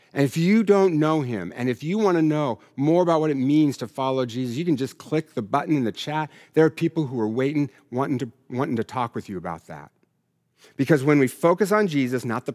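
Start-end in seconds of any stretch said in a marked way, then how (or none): none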